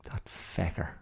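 whispered mock curse; mock duck grumble. Ducks cursing the swimmer, in a mock whisper. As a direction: grumble it under your breath like an annoyed duck.

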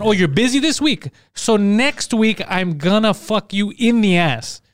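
very slow, deliberate quote; slow retelling. Retold or imitated. Man replaying a crude message to friends, slow and deliberate. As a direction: spell it out slowly, word by word. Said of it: "it's very quick to tell someone".

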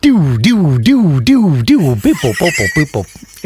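sung electronic beeping noises; toy robot beeps. Retold or imitated. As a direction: imitate a toy's beeps. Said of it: "does exactly the same noises".

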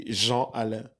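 Jean Alain with nasal endings and no N sounded; French pronunciation demo. Shown as a demonstration. Guest shows host his name, careful French pronunciation. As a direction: say Jean Alain the native French way, final N silent. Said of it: "I just pronounce it".

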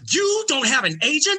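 can't believe he's unsigned; astonished agent. Retold or imitated. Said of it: "agent continues".

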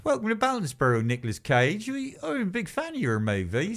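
high-pitched mock Somerset fan; Somerset villager. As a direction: gush in a put-on, high West Country accent from Somerset. Somerset villager welcomes Nicolas Cage.

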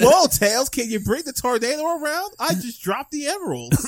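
cartoon hero voice. Voicing Sonic to Tails.

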